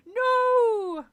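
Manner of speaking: long, high, loud wail; horrified cry. Acted. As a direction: cry out in horror. Counselor plunges into the water.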